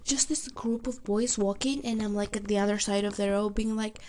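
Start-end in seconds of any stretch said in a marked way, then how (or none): none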